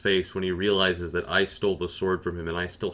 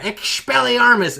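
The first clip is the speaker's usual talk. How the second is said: high, loud, drawn-out incantation; yelled spell; 13 semitones higher, 6 dB louder, slower than usual. Retold or imitated. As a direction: yell it out like a spell in battle. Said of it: "He just yells out in the chat".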